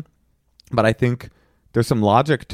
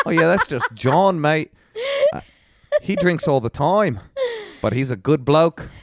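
laughing mock Aussie bloke, higher; Australian accent; 7 semitones higher than usual. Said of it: "He's like".

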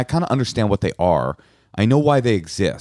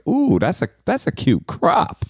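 admiring coo; 8 semitones higher, slower than usual. Retold or imitated. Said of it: "and I think".

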